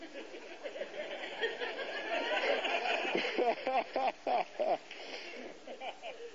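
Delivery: long, high, breathy laugh. Real, not acted. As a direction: laugh at your own story. Laughs after his own story.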